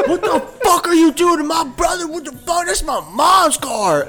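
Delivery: Brian's furious yell. Retold or imitated. Friend retelling Brian storming out at the cop, high furious shout. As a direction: scream it like a raging brother. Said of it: "Comes out of this car like somebody just beat his".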